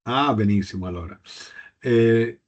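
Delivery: usual speaking voice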